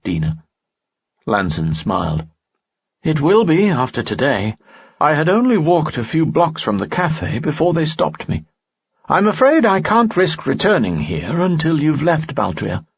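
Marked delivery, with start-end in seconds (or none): 3.1-12.8 higher, courtly nobleman voice; a charming noble, pitched up; Lanson's voice. Acted. Lanson speaks in story.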